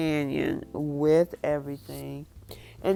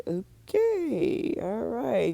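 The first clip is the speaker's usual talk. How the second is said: awkward okay; 6 semitones higher, much slower than usual. Retold or imitated. Host reacts to awkward text.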